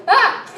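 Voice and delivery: short, high, loud shriek; jump-scare yelp, loud and high; imitated startled scream. Sister startled by horror show.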